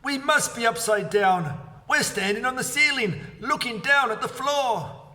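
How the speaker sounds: high, urgent alarm; Mister Twit alarmed, staring down at the floor. A narrator's reading. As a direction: babble it in fear. Husband alarmed, upside down.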